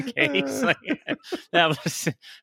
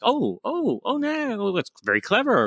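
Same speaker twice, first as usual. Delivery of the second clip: high, squeaky mock amazement; surprised delight; 11 semitones higher than usual. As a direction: gasp it out like a delighted fan. Fan spotting a clever callback.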